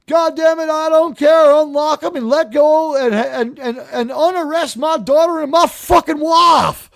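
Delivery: hammed-up yell down the phone at the sheriff, with a laugh breaking through; retold phone rant. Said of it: "screaming match with his phone".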